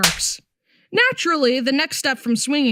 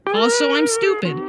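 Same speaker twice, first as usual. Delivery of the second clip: high silly voice; 10 semitones higher than usual. Acted. Self-mocking aside.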